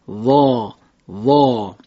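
وا said thick like ظا, tongue hollowed; ظا درشت; heavy emphatic za. Shown as a demonstration. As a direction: make وا dark and heavy. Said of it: "باید ما درشت ادا بکنیم".

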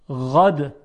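غد opened on a soft continuous غ; soft ghayn. Shown as a demonstration. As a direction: let the first sound of غد flow. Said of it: "حالا غد با غین".

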